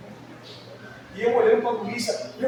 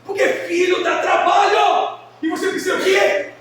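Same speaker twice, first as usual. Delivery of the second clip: preaching shout; 7 semitones higher, 6 dB louder than usual. Real, not acted. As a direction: shout the point home to the congregation.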